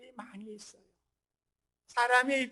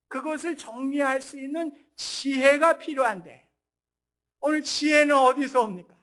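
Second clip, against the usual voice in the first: loud, raised pulpit proclamation; impassioned preaching; 3 semitones higher, 11 dB louder than usual. Real, not acted. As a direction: preach with rising force, hitting key words hard. Preaching at full force.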